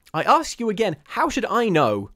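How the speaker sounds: high, loud, agitated retort; exasperated retort. A narrator's reading. Caroline to her questioning sister, exasperated. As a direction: throw the question back angrily.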